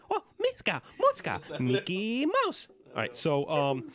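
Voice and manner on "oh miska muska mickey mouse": very high cartoon falsetto; Mickey Mouse. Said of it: "you went on Mickey Mouse right there".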